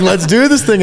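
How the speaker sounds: match his energy, ready to go; hyped. Retold or imitated. Speaker answering his friend's hype, excited.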